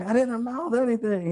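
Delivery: very high mock protest; retold denial. Clerk denying he signalled the cop, mock indignant protest.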